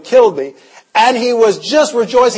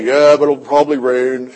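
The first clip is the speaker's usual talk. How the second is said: lower, mopey imitation of a gloomy man; gloomy Eeyore voice; 5 semitones lower than usual. Pastor imitating the negative church member, low and gloomy. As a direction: droop it down like Eeyore, glum and lower. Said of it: "He talked like that too".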